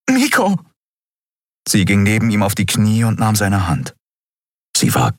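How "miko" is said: very high, anguished call of a name; panicked cry. A narrator's reading. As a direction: cry out for a fallen friend, high-pitched. Endriel runs to fallen boy. (hoher, verzweifelter Ruf)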